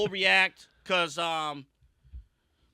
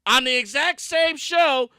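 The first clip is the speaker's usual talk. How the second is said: loud, raised ranting; angry rant; 6 semitones higher, 5 dB louder than usual. Real, not acted. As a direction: vent the outrage. Heated rant continues.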